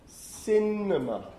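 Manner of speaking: Cinema drawn out slowly, stressed on the first syllable. Shown as a demonstration. Lecturer illustrating third-last stress, Cinema said slowly with first syllable stressed. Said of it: "the stress is on the third last syllable".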